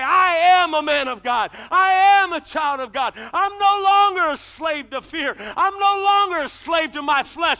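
booming, slowing to a shouted climax; preacher declaring faith to the congregation; preaching at full force. Acted. Preacher to congregation, full force shouted declaration.

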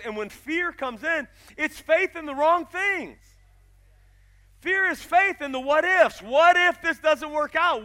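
loud, ringing sermon build with slow emphatic phrases; preaching at full force. Acted. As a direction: drive it home to the congregation. Preaching climax.

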